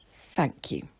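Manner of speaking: soft thanks. Acted. Grateful repeated thanks.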